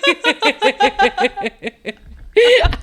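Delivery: wheezing laugh; high laughing fit. Real, not acted. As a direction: break into laughter.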